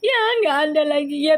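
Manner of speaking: mock abandoned guy. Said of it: "Girando ditinggal nangis ya".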